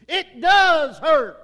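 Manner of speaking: slow, loud shout on does; emphatic shout. Acted. Preacher rebutting the drinker's excuse with a slow loud shout. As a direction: hammer home that it hurts. Rebutting "it don't hurt you.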